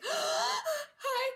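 breathless high hi; flustered gasp. Acted. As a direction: gasp then squeak hi.

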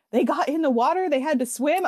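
loud, high-pitched imitation of outraged commenters; hammed-up loud, high protest; mock alarm. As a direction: exaggerate it like a scandalized online crowd. Mimicking alarmed commenters' exaggerated claim.

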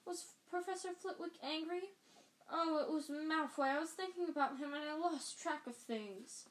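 put-on high, near-tearful girl voice; a hint of crying in it; lightly voiced upset schoolgirl; distressed Hermione.

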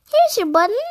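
high, loud call of discovery; eager frog announcing a find. A narrator's reading. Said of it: "said Frog".

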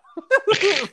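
high burst of laughter; loud laugh. Real, not acted. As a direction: crack up out loud.

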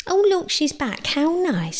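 high, sneering sing-song witch; sarcastic. Acted. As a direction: drip false sweetness on it. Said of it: "Laced with poison".

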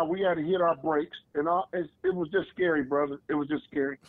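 ordinary delivery

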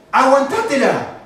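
loud, high, drawn-out retort; mock brazen outburst; defiant dismissal. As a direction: throw it back defiantly, stretching the word. Typical unrepentant person snapping back.

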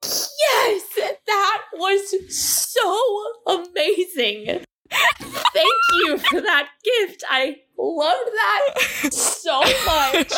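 slow, tearful-laughing delight; real emotional gushing, half laughing, half crying; overjoyed. Real, not acted. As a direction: gush as if moved to tears. Co-host overjoyed after hearing poem.

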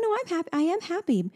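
retold high-pitched mommy reassurance; reassuring mom. Mom reassuring toddler in retold exchange.

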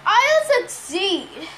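slow, shaky promise; trembling voice. A narrator's reading. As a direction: voice trembling with fear. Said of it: "her voice trembling".

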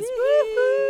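high excited whoop; excited cheer. Acted. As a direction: whoop it like a fan celebrating. Reading fan's cheer.

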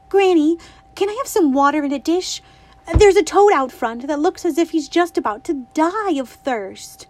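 put-on a bright little girl asking her granny; girlish and eager, lightly voiced; Winnie's voice. A narrator's reading.

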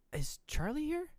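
high, rising put-on voice; odd sudden question. Imitating mother snapping out of terror, high odd question.

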